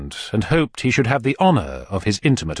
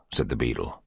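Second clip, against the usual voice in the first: plain reading voice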